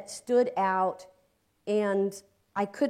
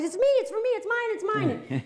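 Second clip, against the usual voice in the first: put-on kid grabbing the phone; 13 semitones higher, 4 dB louder, faster than usual. Kids fighting over the phone.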